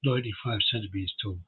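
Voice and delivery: ordinary talk, nothing marked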